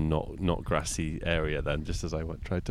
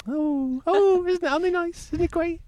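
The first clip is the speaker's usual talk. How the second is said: very high squeaky put-on voice; hammed-up cutesy admiration; mock gushing; 6 dB louder than usual. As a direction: squeal with pretend delight.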